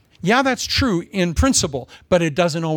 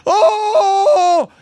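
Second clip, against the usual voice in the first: mock wail; 9 dB louder than usual. Mocking the wailing friend.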